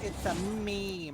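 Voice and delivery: very slow, drawn-out mock delivery; deadpan punchline. Boyfriend explains the prank to his girlfriend, slow mock deadpan. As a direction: stretch each word out for the joke. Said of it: "But then he goes".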